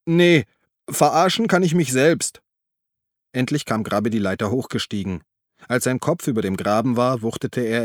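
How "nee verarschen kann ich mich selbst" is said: high, loud indignant comeback; annoyed retort. A narrator's reading. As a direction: throw the offer back angrily. Cursing man snaps back from ditch. (gereizt, laut zurückgerufen)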